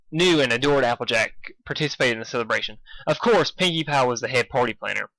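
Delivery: ordinary delivery, nothing marked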